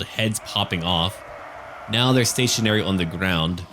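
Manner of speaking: ordinary talk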